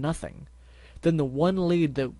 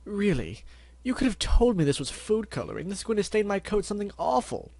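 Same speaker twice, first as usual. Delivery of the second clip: high, fussy posh mare voice; Rarity voice; 8 semitones higher than usual. Acted. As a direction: play the fussy fashion pony. Rarity voiced, upset about stain.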